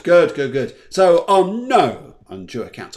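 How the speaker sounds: normal talking voice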